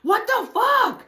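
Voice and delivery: very high, drawn-out shout; exasperated yell. Retold or imitated. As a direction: holler in alarmed disbelief. Re-enacts disbelief mid-fight.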